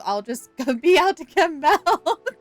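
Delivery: slow it down and laugh through it; laughing mock quote. Streamer jokes about someone who never comes back, laughing mock quote. Joking quote of absent parent.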